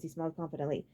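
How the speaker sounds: plain reading voice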